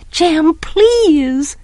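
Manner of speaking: high-pitched, drawn-out plea; pleading. A narrator's reading. Scout begging Jem not to go, pleading.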